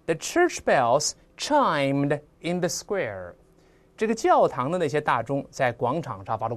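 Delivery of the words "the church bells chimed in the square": each word of "The church bells chimed in the square" drawn out; teacher reads the example sentence for learners slowly; slow example reading. Shown as a demonstration. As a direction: dictate the sentence slowly to the class. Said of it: "你看".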